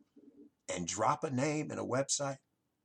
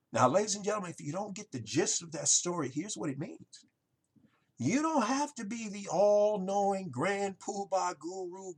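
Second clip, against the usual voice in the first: ordinary talk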